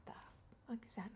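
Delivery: hushed, unvoiced mock alarm at a noise in the dark; startled whispered retelling. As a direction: whisper the shock as if the cats could hear. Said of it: "And I was like".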